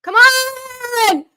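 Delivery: long, high, loud cry; frustrated shout. Real, not acted. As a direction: yell at the screen in frustration. Chance missed in match.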